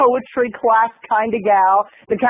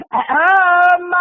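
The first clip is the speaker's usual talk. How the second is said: loud, high, drawn-out "ahem"; mock throat-clearing; 8 semitones higher, 3 dB louder than usual. Annoyed moviegoer turning round to the seat behind, exaggerated throat-clearing. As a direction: imitate an indignant attention-getting "um". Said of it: "she keeps turning around and going".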